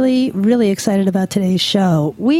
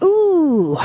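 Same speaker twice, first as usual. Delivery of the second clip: high, drawn-out mock "ooh"; exaggerated rising-and-falling coo of wonder; 8 semitones higher than usual. Host mimics her reaction to hearing Latin names, playful awe. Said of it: "it's like".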